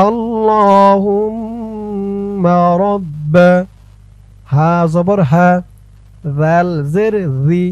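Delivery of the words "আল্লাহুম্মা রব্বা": slow recitation. Shown as a demonstration. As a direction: chant it out slowly. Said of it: "সহি শুদ্ধ করে মুখস্থ করতে হবে". (ধীরে সুর করে আল্লাহুম্মা)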